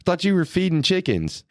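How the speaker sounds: high, laughing what?; laughing disbelief. Retold or imitated. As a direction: retell your shock through laughter. Retells own shocked reaction laughing.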